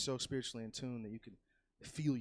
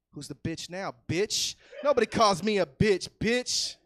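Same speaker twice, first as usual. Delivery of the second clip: rising, louder and higher to a shout; building taunting yell; 8 semitones higher, 14 dB louder than usual. Retold or imitated. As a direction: taunt him harder and harder until yelling. Taunting the crying child.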